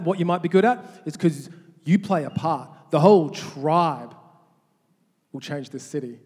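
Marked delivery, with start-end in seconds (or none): 2.9-4.1 The whole tribe slow and loud, each word weighted; emphatic preaching. Acted. Sermon climax.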